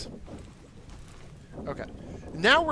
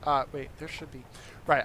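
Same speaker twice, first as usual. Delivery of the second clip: usual speaking voice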